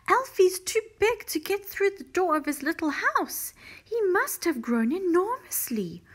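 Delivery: high, loud, amazed lady; excited shouting. A narrator's reading. Missus Silver shouting about her grown tortoise, excitedly. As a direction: shout the astonishing news up to a neighbour. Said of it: "Missus Silver was shouting".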